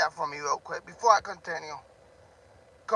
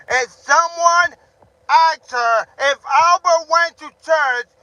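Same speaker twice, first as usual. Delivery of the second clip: emphatic shout; 8 semitones higher, 11 dB louder, slower than usual. Real, not acted. Angry rant repeating the question.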